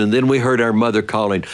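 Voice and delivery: usual speaking voice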